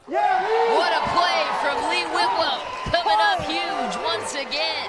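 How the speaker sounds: loud, high, slow excited call; excited shout. Real, not acted. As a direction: roar out the big play. Commentator reacts to a great point.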